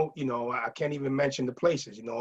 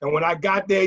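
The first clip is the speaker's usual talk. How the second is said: ordinary delivery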